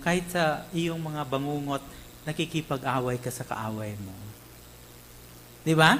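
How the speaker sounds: normal talking voice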